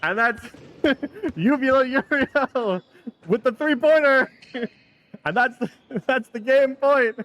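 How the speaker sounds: playful mock arena announcer, laughing through it; gym announcer. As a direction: call the three-pointer over the gym PA. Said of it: "am I the announcer".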